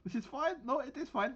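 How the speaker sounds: high, hammed-up German commander rallying comrades; over-the-top German accent, high and insistent; mock German accent. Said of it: "I don't know why I'm German now".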